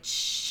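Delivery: unvoiced static shush; static hiss. Shown as a demonstration. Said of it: "it has, like, staticky".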